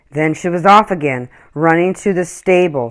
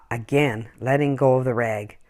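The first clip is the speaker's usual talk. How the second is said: ordinary delivery, nothing marked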